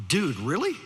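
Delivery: mock Valley-dude disbelief; Valley teenager. Sophomore looks at preacher, mock Valley-teen question. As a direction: lightly imitate a surprised Valley kid, rising. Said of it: "you know how they talk".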